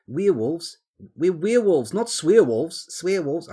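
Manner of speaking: high, loud mock Kiwi accent; New Zealand accent. Streamer attempting a Kiwi film quote, in a New Zealand accent. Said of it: "sounds a bit Kiwi to you".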